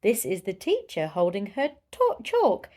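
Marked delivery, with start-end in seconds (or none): none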